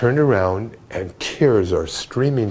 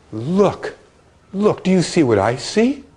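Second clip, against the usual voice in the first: excited; 9 semitones higher than usual. Retold or imitated. [name] pointing at the sky.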